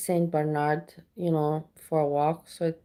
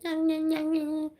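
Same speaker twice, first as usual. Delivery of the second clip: much higher, mocking nyah-nyah taunt; 10 semitones higher than usual. Taunting rage baiters, much higher nyah-nyah. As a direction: tease like a kid.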